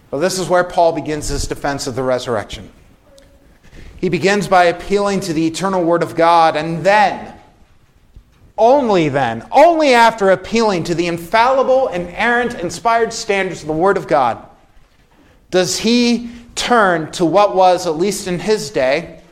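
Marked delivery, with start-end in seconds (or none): none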